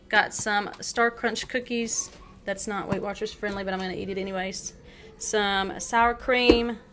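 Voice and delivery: normal talking voice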